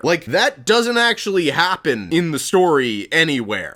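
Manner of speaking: usual speaking voice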